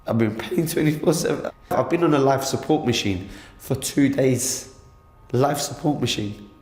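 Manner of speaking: upset, pitch climbing as the ordeal pours out; real distress. Real, not acted. Attack victim telling a reporter of life support, distressed. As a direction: voice rising high with distress.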